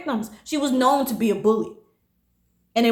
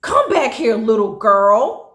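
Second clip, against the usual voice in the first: imitated yell; 5 dB louder, slower than usual. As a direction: mimic a boss calling someone back, condescending. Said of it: "the manager yelled at me".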